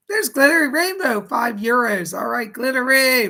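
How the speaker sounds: loud, high, cheering welcome; excited shout-out. Real, not acted. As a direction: shout the donor's name with delight. Hyping a new donor.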